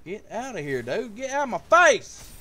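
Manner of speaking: rising to a loud, high finish; dismissive outburst. Real, not acted. Player to opponent on stream, dismissive outburst. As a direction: shove it away, exploding on the last phrase. Rebuking something during live card game.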